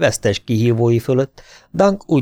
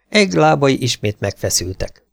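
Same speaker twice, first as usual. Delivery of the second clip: ordinary delivery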